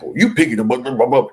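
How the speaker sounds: loud, high blah-blah rant; mock yelling. Host retelling Meredith's tirade at Brittany, mock yelling. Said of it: "going off, yelling, hollering".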